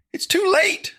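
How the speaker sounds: high, loud shrieking witch; shrill cackling witch shriek. Acted. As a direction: screech it like a witch. Witch mommy's voice echoing.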